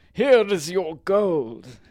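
slow, loud, high proclamation; story wizard. A narrator's reading. Wizard shows the baron the sacks, slow loud proclamation. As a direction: announce it slowly and loudly as the wizard. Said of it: "the wizard showed him the sacks".

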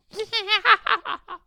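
loud, very high witch cackle; witch's cackle. A narrator's reading. Witch running off from Jane, high cackling. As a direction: cackle shrilly and wickedly.

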